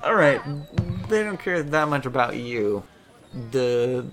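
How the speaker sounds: ordinary talk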